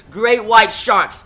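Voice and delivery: ordinary delivery, nothing marked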